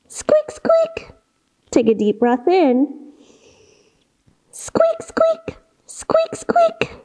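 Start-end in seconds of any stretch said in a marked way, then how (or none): 0.1-1.1 tiny whispered squeaks, much higher; whispered mouse squeaks. Shown as a demonstration. Teacher squeaks like a mouse in mouse pose, whispered and much higher. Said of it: "give me a little squeak". 4.5-7.0 tiny whispered squeaks, much higher; whispered mouse squeaks. Shown as a demonstration. Teacher squeaks like a mouse in mouse pose, whispered and much higher. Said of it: "give me a little squeak".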